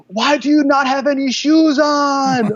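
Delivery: high, slow, exaggerated mock coach voice; mocking his coach. Retelling how his coach scolded a student who threw up, in a high, slow mock voice. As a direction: ham up a baffled instructor. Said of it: "then Edson's like".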